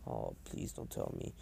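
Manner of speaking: breathy whispered groan of dread. Retold or imitated. Naruto whispering in dread as Sakura approaches. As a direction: whisper it like you already know who's coming.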